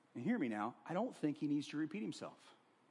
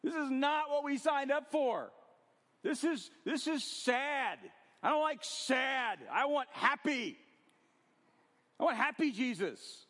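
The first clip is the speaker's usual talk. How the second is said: a hint of a complaining disciple, higher; whiny disciple; 9 semitones higher, 3 dB louder than usual. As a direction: lightly whine like a let-down follower. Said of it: "you're already like".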